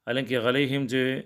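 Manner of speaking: normal talking voice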